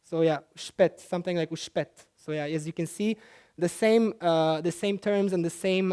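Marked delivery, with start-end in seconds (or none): 0.6-1.0 uspet with s said as sh, ush-PET; Neapolitan sh sound. Shown as a demonstration. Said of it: "often have the sh sounds". 1.5-1.9 uspet with s said as sh, ush-PET; Neapolitan sh sound. Shown as a demonstration. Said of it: "often have the sh sounds".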